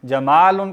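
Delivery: जमालुन with the middle AA held long; lengthened alif. Shown as a demonstration. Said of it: "अलिफ शामिल हो गया". (جَمالٌ، الف کھینچ کر)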